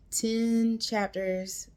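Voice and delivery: ten chapters stretched slow and high; drawn-out emphasis. Real, not acted. Gushing to viewers, drawing out ten chapters. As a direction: lean hard on ten chapters, dragging it out.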